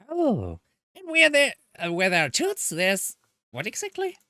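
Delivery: thrilled at spotting tools; excited discovery. Acted. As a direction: burst out with the idea. Excited find in dark basement.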